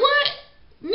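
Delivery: a high, loud, drawn-out what; stunned. Retold or imitated. Host hearing the catfish's excuse, shrieking in shock. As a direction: yelp it in total disbelief. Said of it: "He was like".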